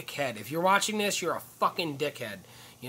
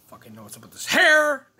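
higher, louder exasperated blurt; frustrated outburst; 10 semitones higher, 9 dB louder than usual. Real, not acted. Fussing over his hair on camera, exasperated outburst.

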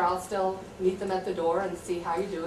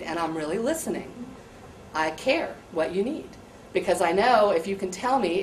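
ordinary delivery

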